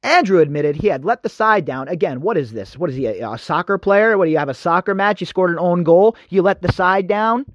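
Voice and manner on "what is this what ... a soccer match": ordinary delivery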